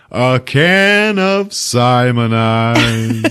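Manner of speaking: slow, drawn-out thrilled dad; hammed-up pleased father; mock delight. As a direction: savor it like a treasure. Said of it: "he goes".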